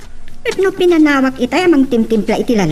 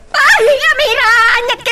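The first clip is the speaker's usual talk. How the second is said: alarmed cry; 9 semitones higher than usual. Acted. Mother cries out at disaster.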